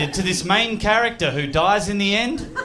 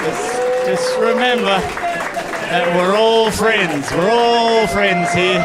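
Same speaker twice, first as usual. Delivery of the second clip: mock plea; 4 dB louder, slower than usual. Sarcastic plea for harmony.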